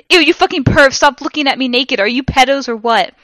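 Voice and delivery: high, loud disgusted yell; screamed at the pervs. A narrator's reading. Heroine yelling at spying teachers, loud and disgusted. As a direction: shriek at them to stop looking. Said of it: "I screamed".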